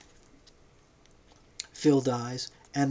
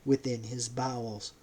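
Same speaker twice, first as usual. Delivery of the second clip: plain reading voice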